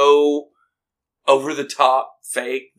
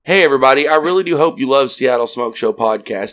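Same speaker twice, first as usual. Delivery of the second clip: ad read; 5 dB louder, faster than usual. Acted. Podcast ad read.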